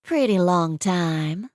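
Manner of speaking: slow, stretched Southern drawl, hammed up; exaggerated drawl. Maggie teasing Cole with a put-on drawl. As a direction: draw the words out like a pageant queen teasing. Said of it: "said with an exaggerated drawl".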